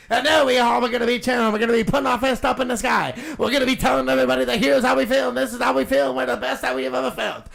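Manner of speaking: hammed-up rally cheer. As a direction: pump up the crowd, laughing. Hammed rally speech, getting louder.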